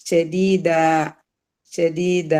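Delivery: ഷദീദ with a long drawn final aa; full-stop madd. Shown as a demonstration. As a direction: stop on ഷദീദ with an open, lengthened ending. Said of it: "ഒരു മദ്ദും വെച്ചിട്ടാണ് അതിനെ full stop".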